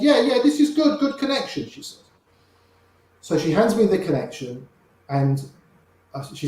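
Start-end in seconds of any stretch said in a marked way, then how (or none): 0.0-1.7 high, squeaky attempt at a French accent; hammed-up French receptionist accent, pitched high; mock French accent. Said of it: "I can't do a French accent".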